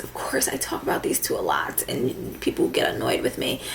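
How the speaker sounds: hushed, sheepish whisper; whispered admission to the viewers. Real, not acted. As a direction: whisper it like a guilty confession. Sheepish aside about favourite gurus.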